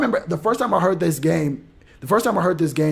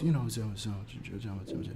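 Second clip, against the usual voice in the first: mumbled dodge; 6 semitones lower, 14 dB quieter than usual. Retold or imitated. Evasive answer trailing off.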